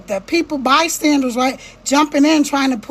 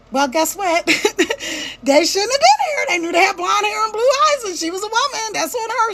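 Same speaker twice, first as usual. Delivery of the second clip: smug bystander; 5 semitones higher than usual. Retold or imitated. Mocking callous bystander.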